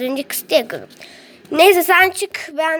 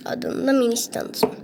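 ordinary delivery

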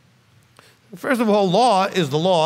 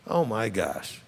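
ordinary talk

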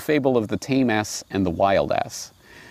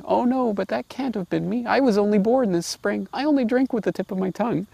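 high, quick little lamb voice; innocent lamb; 12 semitones higher than usual. Retold or imitated. Lamb answering the wolf politely, in a high quick little voice. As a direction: play the meek lamb answering politely, light and fast.

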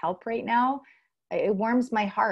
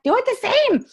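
high, loud insistent command; bossy coach; 9 dB louder than usual. Retold or imitated. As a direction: insist firmly, pitched way up. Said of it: "I'm like".

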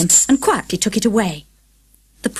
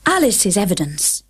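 plain reading voice

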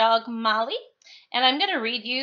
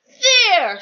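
short loud triumphant high cry; Pig shouting; 10 semitones higher, 10 dB louder than usual. Acted. Pug shouts in triumph atop his pile, loud and high. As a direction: bark it out in triumph, higher and louder. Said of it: "shouted Pig".

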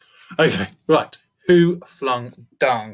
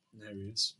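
ordinary delivery, nothing marked

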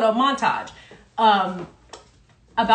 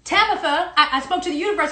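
ordinary delivery

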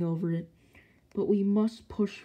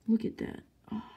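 ordinary delivery

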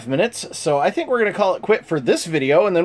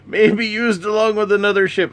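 put-on higher pirate accent with a swagger; 6 semitones higher than usual. Reading pirate cards aloud in a higher pirate accent. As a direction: read it like a pirate captain, pitched up. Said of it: "I'm gonna do a pirate accent for all of this".

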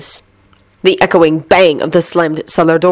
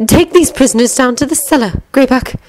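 Narcissa voice; 7 semitones higher than usual. A narrator's reading.

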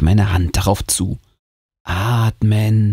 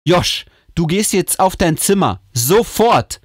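angry; 9 semitones higher than usual. Acted. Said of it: "sagt meine Mama wütend".

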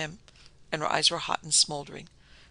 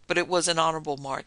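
usual speaking voice

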